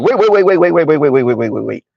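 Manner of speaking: rapid-fire run of waits, sliding down in pitch; mock alarmed objection. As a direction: cut in with a hasty, repeated halt. Acting out sudden objection to money talk.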